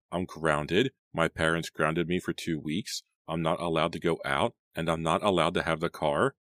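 usual speaking voice

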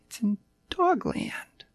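high, eager little puppy; a lost small pup, chirpy and sweet; yappy panting puppy. Acted.

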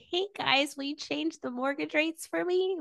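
high, small mock-pleading voice. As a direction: pitch it way up, like a little mouse asking a favor. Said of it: "it was in a higher pitch voice".

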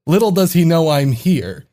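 fast read of the texts; reading messages aloud. Real, not acted. Reading a friend's texts aloud on the podcast, fast. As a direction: rattle the messages off quickly. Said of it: "He just messaged me".

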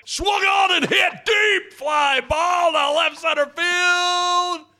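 rising excited home-run call, drawn out; play-by-play call. Shown as a demonstration. Host asked for a baseball call, excited play-by-play. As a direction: call the homer like a radio announcer. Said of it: "Could you do a little play-by-play of baseball".

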